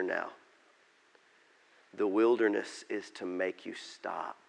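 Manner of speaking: slow the thought down and speak gently; quiet, earnest pastoral appeal; hushed sermon close. Real, not acted.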